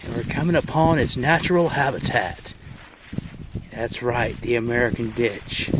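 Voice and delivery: excitable Aussie wildlife host; nature documentary. Pretend. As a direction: narrate like a croc hunter. Mock wildlife hunt intro.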